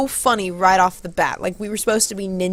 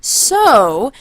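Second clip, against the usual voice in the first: higher, louder stagey announcement; performing to crowd; 4 semitones higher, 6 dB louder, slower than usual. Retold or imitated. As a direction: present your move grandly to an audience. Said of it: "so I was like".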